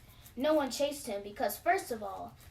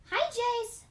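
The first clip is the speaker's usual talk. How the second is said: high, sweet big-sister voice; a little sister's sugary high hello; sweet greeting; 11 semitones higher than usual. A narrator's reading. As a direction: greet him brightly, all sweetness. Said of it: "she said with a warm smile".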